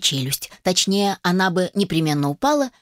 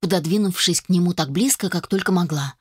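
ordinary delivery, nothing marked

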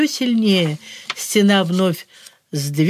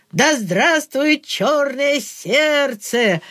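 foolish cheer; 4 semitones higher, much slower than usual. A narrator's reading. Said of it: "с глупым видом".

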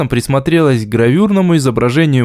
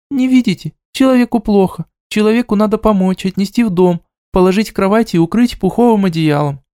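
indignant pleading; 7 semitones higher than usual. A narrator's reading. Deyu demands to be pitied.

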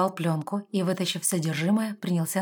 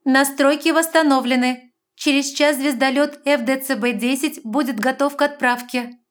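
loud, high computer announcement voice; ship's system voice, announcing; loud female announcer voice; 7 semitones higher, 8 dB louder than usual. Acted. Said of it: "Раздался громкий женский голос".

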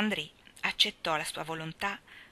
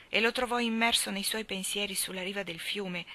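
usual speaking voice

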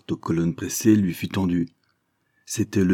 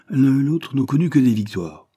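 louder, higher proud finish; triumphant captain; 7 semitones higher, 5 dB louder than usual. Acted. Captain crowning his general's boast, triumphant.

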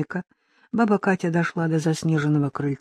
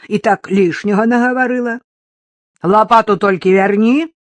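put-on old woman warning; 3 semitones higher, 7 dB louder than usual. Old woman urging the reporter to leave, stern. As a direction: send the girl away firmly.

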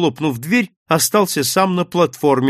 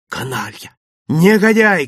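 angry insults, bursting louder; hoarse shout; 3 semitones higher, 4 dB louder than usual. A narrator's reading. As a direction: spit out insults, building to a cry. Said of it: "кричал я охрипшим голосом".

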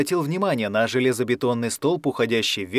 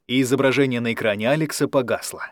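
usual speaking voice

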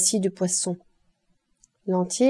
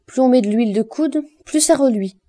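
higher, louder pronouncement of a maxim; sententious; 4 semitones higher, 5 dB louder than usual. A narrator's reading. Lantier comments to the woman scrubbing, sententious. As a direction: deliver it like a pompous proverb. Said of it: "dit sentencieusement Lantier".

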